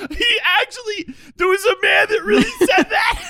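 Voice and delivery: very high, strained crying squeal; exaggerated weepy explanation; imitating a tearful voice. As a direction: wail it as if choking up, hammed up. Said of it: "Lexi's crying, so I'm just like".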